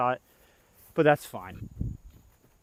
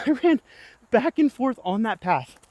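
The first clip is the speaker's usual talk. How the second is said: laughing retelling; 10 semitones higher, 6 dB louder than usual. Retold or imitated. Retelling the bee chase, laughing.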